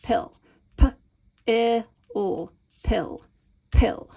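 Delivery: "pill" said clearly on its own; isolated syllable. Shown as a demonstration. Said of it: "first part of pileated".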